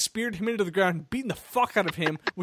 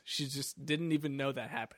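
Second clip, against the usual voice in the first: normal talking voice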